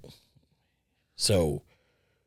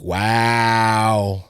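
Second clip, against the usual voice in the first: 1 stunned wow; 6 dB louder than usual. Retold or imitated. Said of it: "And I'm like".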